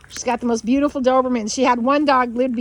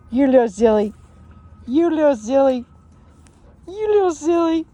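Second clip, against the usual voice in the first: louder, higher doting coo; 3 semitones higher, 6 dB louder than usual. Real, not acted. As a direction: tease the puppy fondly. Doting talk to a puppy.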